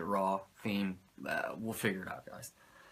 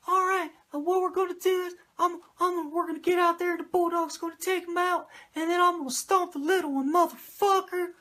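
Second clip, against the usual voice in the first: much higher squeaky imitation of a manager; Cornette's high-pitched voice. Cornette plans the beatdown in a high-pitched mock voice. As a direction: ramble the plan in a strained falsetto. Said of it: "Cornette with his fucking high-pitched voice".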